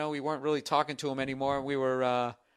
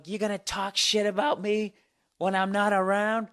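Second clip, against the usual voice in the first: higher-pitched imitation of an accuser; mock angry confrontation; 7 semitones higher, 3 dB louder than usual. Woman confronting man who joked about her, mock angry. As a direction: challenge him as the offended woman, pitched up.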